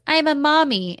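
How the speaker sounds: a touch of a cutesy woman voice; mock cutesy mommy. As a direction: play her coy and sweet, slightly up in pitch. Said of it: "she goes".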